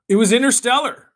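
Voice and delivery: loud, high burst of realization; sudden recall. Real, not acted. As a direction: blurt it out as it comes back to you. Suddenly remembers the film title.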